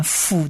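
辅 standing for the soft f/v sound; 辅 as a letter sound. Shown as a demonstration. Teacher explains Bet without a dot, 辅 as "fu". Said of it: "就是念辅的音".

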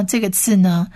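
ordinary delivery

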